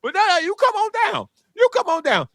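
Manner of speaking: lightly mock an eager host: come on down; city officials as a hammed-up game-show host; game-show invite. Officials voiced pitching toxic land.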